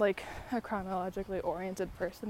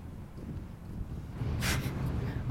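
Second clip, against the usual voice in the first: breathy laugh. Real, not acted. Self-mocking about the podcast.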